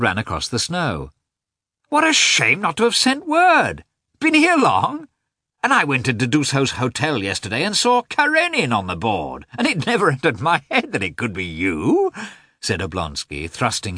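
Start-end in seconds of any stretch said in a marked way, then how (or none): 1.9-12.1 eager greeting. Acted. Oblonsky greeting Karenin eagerly.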